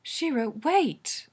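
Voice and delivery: insist, stretching the word out; insistent. A narrator's reading. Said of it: "Allura insists".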